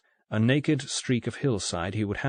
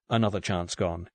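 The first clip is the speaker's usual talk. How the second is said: ordinary delivery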